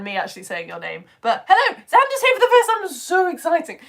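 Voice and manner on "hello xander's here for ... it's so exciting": high, very loud gush of excitement; excited welcome. Real, not acted. Host greeting first-time viewer Xander, excited welcome. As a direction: cheer the newcomer at full pitch.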